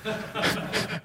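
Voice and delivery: unvoiced quiet laugh; hushed airy laugh after the punchline; breathy laugh. Real, not acted. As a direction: let out a soft airy chuckle. Laughs at own story.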